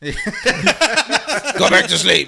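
high, slow, laughing mock grumble; mocking the grumpy parents, laughing. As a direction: drag it out through laughter.

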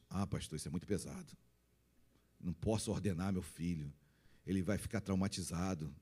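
low, soft mock complaint; objecting parishioner. Parent objecting to the pastor about ordering a child, mock reluctant.

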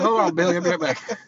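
loud, high, telling Billy to wait; hurried excited shout; excited call-out. Retold or imitated. Telling Billy he'll be right back, loud excited call. Rushing off to check.

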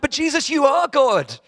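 high, loud, stammering bafflement; acting out utter confusion at what was heard; retold incredulity. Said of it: "And I'm like".

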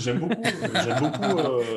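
high, laughing through the words. Real, not acted. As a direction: laugh as you say it. Laughs while saying he enjoys it. (en riant, plus aigu)

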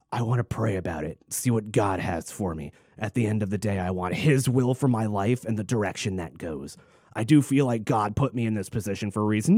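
low, soft churchy mock; mock pious voice. Quoting Jared Schmeck's pious interview in a low mock voice. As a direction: mimic a pious sincere tone. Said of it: "to which he said".

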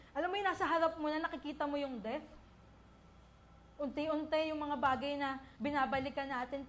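ordinary talk, nothing marked

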